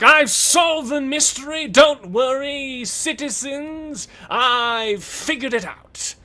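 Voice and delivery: high, loud, slow grandstanding; pompous hero shout. Acted. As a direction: announce it to the crowd like a vain hero. GM voices pompous detective.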